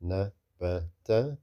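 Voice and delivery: "nabata" slowly, three short syllables with gaps. Shown as a demonstration. Teacher shows short sounds read slow, syllable by syllable. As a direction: separate na, ba, ta in "nabata", vowels still short. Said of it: "Whether you read it slow or fast".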